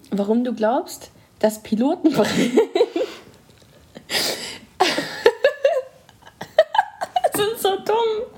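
barely holding the laughter in; stifled giggles. Real, not acted. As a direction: pitch jumps as laughter breaks through. Laugh breaks through the question.

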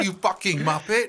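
hammed-up British insult; comic Brit slinging an insult; mock British accent. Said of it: "What do they say?".

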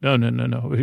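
ordinary talk

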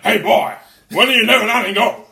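high, loud hammed-up bluster; Al Pacino impression. Player blustering at Meslier, loud Pacino impression. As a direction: holler like an overacting film star. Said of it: "recording as Al Pacino".

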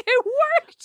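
high, squealed mock victory; re-enacted jubilant squeal; gleeful triumph. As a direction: celebrate a prank that came off. Pranksters celebrate their successful joke.

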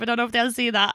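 ordinary talk

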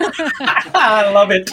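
laughing out loud while saying it; real laughter on every word. Real, not acted. Host laughs at the husband joke.

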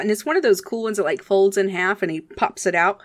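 usual speaking voice

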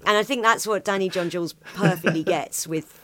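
normal talking voice

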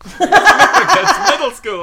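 very high, loud, drawn-out through laughter; laughing mock officer. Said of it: "then he's like".

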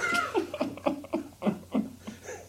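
a silent wheezing laugh, way up high; high breathless laugh. Real, not acted. Host cracks up at his own story with a high wheeze. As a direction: crack up helplessly.

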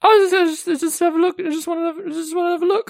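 put-on high, pleading drunk woman; high tipsy whine.

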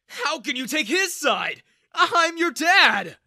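high, loud indignant shout; outraged. A narrator's reading. Father protesting his daughter taking sides, outraged shout. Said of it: "Daniel's eyes flashed".

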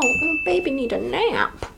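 put-on baby talk. Mocking Raskolnikov sleepy.

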